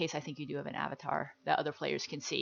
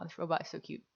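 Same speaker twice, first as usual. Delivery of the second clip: normal talking voice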